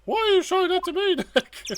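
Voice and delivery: put-on fast, high girlish question breaking into laughter; puzzled young sidekick. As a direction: ask it high and quick, then crack up. Maya puzzled by Nick, reader laughs.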